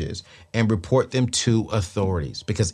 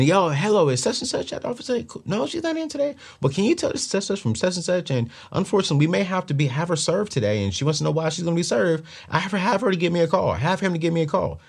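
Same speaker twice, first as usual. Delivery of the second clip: higher, fast, pushy fake phone voice; imitating a debt collector's call; 8 semitones higher, faster than usual. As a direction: put on a sly caller voice to scare them. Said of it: "I used to do this".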